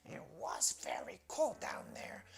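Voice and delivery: voiceless, raspy frog remark; scratchy frog. Acted. Frog back from the well, scratchy croak. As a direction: croak it in a rasp. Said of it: "scratchy voice behind her say".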